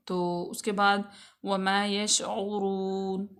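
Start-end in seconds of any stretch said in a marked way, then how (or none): 1.4-3.3 यशऊरून slow, with a full heavy ra. Shown as a demonstration. Teacher shows the heavy ra, slow recitation. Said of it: "रा को हम यहाँ पे पुर पढ़ेंगे".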